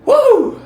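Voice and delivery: very high, loud whoop; shaking off the story, a loud whoop; whooping woo. Real, not acted. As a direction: let out a release whoop. Exclaiming after the story.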